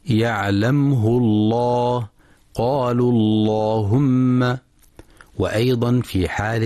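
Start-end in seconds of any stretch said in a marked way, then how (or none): 0.0-4.6 slow recitation with thick dark L in "الله"; round, heavy L in "اللهم", held long; heavy lam. Shown as a demonstration. Teacher showing the name of God after damma, heavy lam. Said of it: "وإن سبقت بضم".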